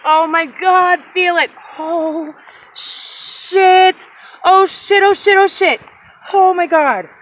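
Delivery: can't contain it; excited squeals. Real, not acted. Touching the arrowhead, overwhelmed.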